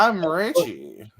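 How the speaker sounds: slow put-on voice as Richie; mocking Richie. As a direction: drawl it out like Richie. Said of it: "mock self-introduction as Richie".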